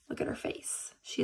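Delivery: whispered aside. Real, not acted. Unvoiced aside.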